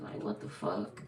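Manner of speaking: low hushed mock "what the fuck"; a hint of someone caught off guard, hushed; offended muttered reaction. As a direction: play the stunned person under your breath. Voicing someone offended by call-out.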